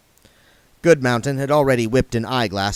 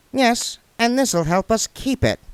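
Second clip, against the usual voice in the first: crook's voice; 13 semitones higher than usual. A narrator's reading. Pin's voice via imp.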